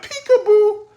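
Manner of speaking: high sing-out on a level note; playful peekaboo call. Retold or imitated. Battle rapper's punchline for the crowd, high peekaboo call. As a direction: imitate a teasing peekaboo. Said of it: "He said it like that".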